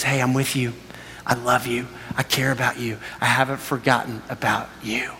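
low, softened, intimate voice; tender reassurance. Acted. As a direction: speak as God gently comforting someone. Voices God reassuring the listener.

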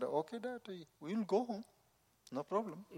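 put-on high, soft child's voice; little daughter. Little daughter comforting her crying father, high and soft. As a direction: pitch up lightly into a little girl.

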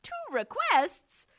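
high, slow, loud offended echo; mock-affronted god of the underworld. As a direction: repeat it as if insulted. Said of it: "Hades is a little offended".

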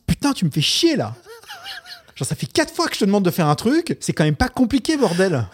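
high, loud exasperated parent outburst; losing his temper. Retold or imitated. Dad telling off his son over a repeated request, exasperated. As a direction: let fly, re-enacting an angry dad. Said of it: "je m'emporte avec mes enfants".

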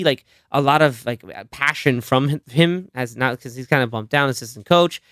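ordinary delivery

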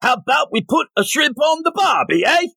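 hammed-up Australian. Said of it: "probably Australian".

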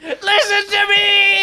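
laughing mock shout. Crying out amid laughter.

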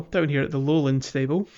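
ordinary talk

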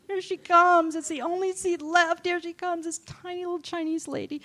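high-pitched, strained running commentary; mock dismay. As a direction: narrate her approach in a high voice. Acted dismay as passenger approaches.

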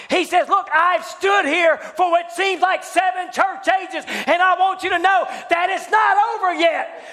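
high, loud revival shout, building; full-force preaching. Real, not acted. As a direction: hammer each phrase to the congregation.

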